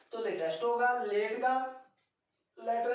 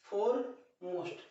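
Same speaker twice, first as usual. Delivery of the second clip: foremost spoken very slowly and clearly; slow model word; much slower than usual. Shown as a demonstration. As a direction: drag out foremost so pupils catch each sound. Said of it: "teacher repeats the word".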